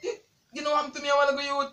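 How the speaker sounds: loud, fast tearful protest; upset outburst. Real, not acted. Pranked partner reproaching the prankster, tearful outburst. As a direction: pour it out loudly, near crying.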